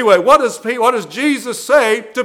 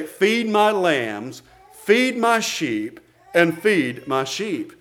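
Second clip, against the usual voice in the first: slow and softer, lingering on each word; quiet charge; 4 semitones lower, 5 dB quieter, slower than usual. Acted. Jesus answering Peter the third time, slow and soft. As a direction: press the charge home gently. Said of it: "what did Jesus say".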